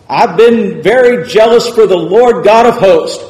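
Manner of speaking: loud, high, full-force preaching; fiery preaching. Acted. Preacher retelling Elijah to the congregation, full-force preaching. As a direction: thunder the story out to the congregation.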